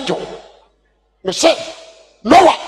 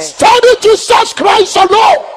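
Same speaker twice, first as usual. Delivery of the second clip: full-force preaching; 6 semitones higher, 10 dB louder than usual. Acted. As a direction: thunder the lesson at the congregation.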